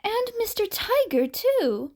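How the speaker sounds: high, quick and eager; eager boy voice. Acted. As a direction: blurt it out like an excited kid. Child excitedly adds to list.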